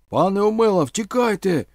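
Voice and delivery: loud, high urgent yell; shouted warning. A narrator's reading. Vasyl warning Omela of the running dog, shouting. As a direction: cry out the alarm at full voice. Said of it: "крикнув Василь".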